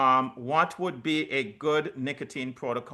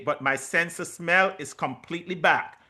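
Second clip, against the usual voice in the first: normal talking voice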